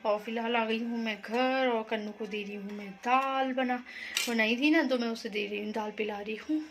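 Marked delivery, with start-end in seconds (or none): none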